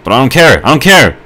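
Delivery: loud, high-pitched defiance; defiant outburst. Real, not acted. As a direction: shout it defiantly, pitch jumping up. Defiant outburst after self-criticism.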